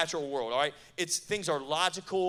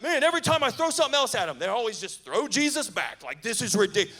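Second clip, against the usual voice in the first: fast, higher exasperated complaint; frustrated enemy; 5 semitones higher, 4 dB louder, faster than usual. Retold or imitated. Preacher voicing the devil fed up with faith, fast exasperated rant. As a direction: rattle it off like a fed-up villain.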